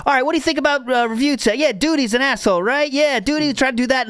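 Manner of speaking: lightly voiced host fishing for a reply; eager prompting. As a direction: coax someone into chatting. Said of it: "Triple P is trying".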